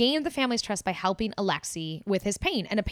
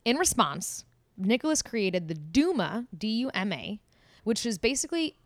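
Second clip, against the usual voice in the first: ordinary talk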